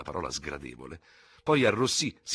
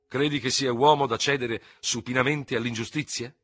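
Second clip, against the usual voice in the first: angry question, louder and higher; irate; 7 semitones higher, 6 dB louder than usual. A narrator's reading. Ferraud challenges his fellow officer over injustice, irate. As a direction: demand it indignantly, voice raised. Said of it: "chiese con voce irosa".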